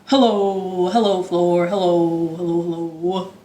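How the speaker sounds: dropped low hellos toward the floor; deep-voiced hellos to the floor. Shown as a demonstration. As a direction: sink your voice down low. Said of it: "Let's say hello as low as we can".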